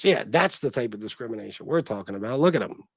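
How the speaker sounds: normal talking voice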